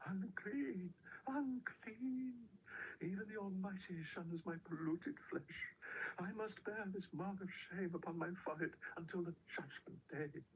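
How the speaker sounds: high, slow anguished wail. A narrator's reading. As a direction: cry out her shame like a leper. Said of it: "she wailed out".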